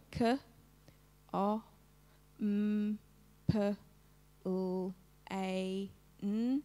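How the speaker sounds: "K, O, M, P, L, A, N" as drawn-out phonemes with long gaps, kuh, o, mmm, puh, lll, ay, nnn; sounded out. Shown as a demonstration. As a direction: lead "K, O, M, P, L, A, N" one sound at a time, slowly. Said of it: "we're going to trace over it".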